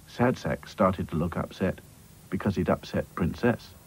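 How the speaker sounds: usual speaking voice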